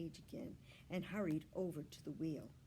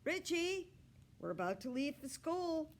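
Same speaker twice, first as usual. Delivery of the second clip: higher calling voice; dad calling; 9 semitones higher, 3 dB louder than usual. A narrator's reading. Dad calling son to leave for school. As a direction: call up the stairs like a dad. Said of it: "his dad called".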